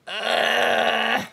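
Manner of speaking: normal talking voice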